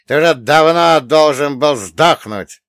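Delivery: громкий злой голос; выкрикни с ненавистью; злобно кричит. Acted. Злодей кричит на старика. (злобный крик)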